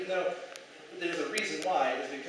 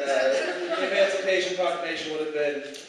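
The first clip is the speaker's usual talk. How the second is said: usual speaking voice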